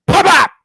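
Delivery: sharp high popping sound; loud imitated pops; arrow pops. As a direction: pop it out like two shots hitting. Mimicking two arrows hitting target.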